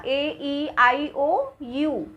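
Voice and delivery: "ए ई आई ओ यू" recited very slowly; teacher chanting the vowels slowly; slow vowel recital. Shown as a demonstration. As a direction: space out each vowel for the class. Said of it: "vowel है ना".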